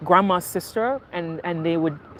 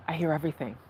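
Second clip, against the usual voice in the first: softer, lower repeat; quiet; 3 semitones lower, 8 dB quieter, faster than usual. Shown as a demonstration. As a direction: let it drop to a murmur.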